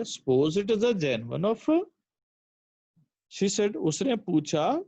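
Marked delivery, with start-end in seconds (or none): 0.0-1.9 slow "Suppose it is a genuine offer" rising like a question; questioning lilt, "offer" swinging up at the end; question intonation. Shown as a demonstration. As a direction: read it slowly as a question, lifting "offer". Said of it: "तो similarly यहाँ पे है".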